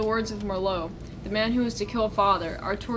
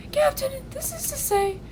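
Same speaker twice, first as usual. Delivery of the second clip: game character. Acted. Child questions the captain in game.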